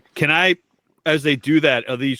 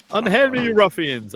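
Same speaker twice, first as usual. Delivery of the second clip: fluttering cries for help; 8 semitones higher than usual. Acted. Prissy captive protest.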